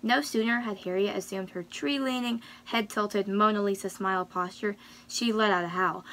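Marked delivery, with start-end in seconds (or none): none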